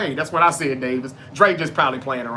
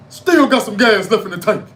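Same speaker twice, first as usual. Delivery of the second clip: loud, raised wrestler promo shout; Mark Henry; 4 semitones higher, 7 dB louder than usual. Retold or imitated. Mark Henry turning on Cena in the promo, loud mock shout. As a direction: throw it out as a defiant ring boast. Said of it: "when Mark Henry was in the".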